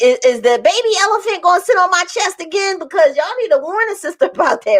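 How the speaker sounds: high, fast mock alarm; retold worry. Patient asking if the pressure returns, comic alarm. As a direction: fire off a comic anxious protest. Acts out worried question to doctors.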